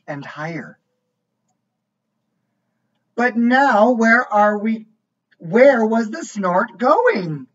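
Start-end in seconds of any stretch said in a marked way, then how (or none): 3.1-7.5 lively storybook voice, each line set apart; reading to a child. A narrator's reading. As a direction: read it like a bedtime book for a little one. Reads picture book aloud.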